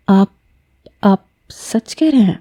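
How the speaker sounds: hesitant, halting disbelief; falter, barely daring to believe it; disbelieving. A narrator's reading. Woman asks if his proposal is true, in disbelief. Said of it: "اس نے بے یقینی سے".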